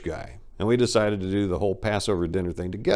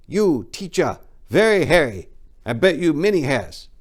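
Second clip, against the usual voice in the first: put-on kid's broken English, higher and halting; imitating a student; 7 semitones higher, 5 dB louder than usual. Middle school kid teasing his teacher in the elevator, higher mock voice. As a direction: pitch it up like a cheeky schoolboy. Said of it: "I hear".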